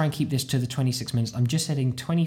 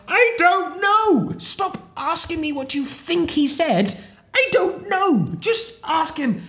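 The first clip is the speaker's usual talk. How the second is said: high, drawn-out shout of mock exasperation; exasperated brain; 5 dB louder, slower than usual. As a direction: yell it slowly, as if utterly fed up. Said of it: "my brain's literally sitting there going".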